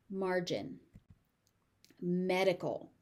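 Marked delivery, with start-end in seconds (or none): none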